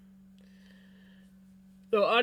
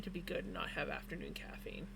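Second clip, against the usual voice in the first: whisper; 3 semitones lower, 11 dB quieter than usual. Real, not acted. Trailing aside about caffeine.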